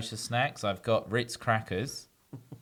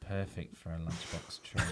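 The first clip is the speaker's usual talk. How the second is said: soft low sultry read; sexy voice; 5 semitones lower, 10 dB quieter than usual. Pretend. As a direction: purr the ad copy softly. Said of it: "That sexy voice again".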